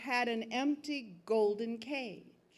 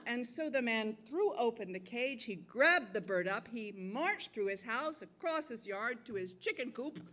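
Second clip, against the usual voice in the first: usual speaking voice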